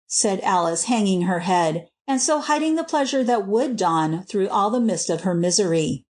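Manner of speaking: plain reading voice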